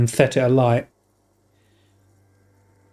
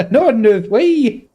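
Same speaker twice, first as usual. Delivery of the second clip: slow, high-pitched mock Scottish; attempted Scottish accent; 8 semitones higher, 3 dB louder, slower than usual. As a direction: stretch it out high in a badly faked Scottish accent. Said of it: "I'm doing Scottish".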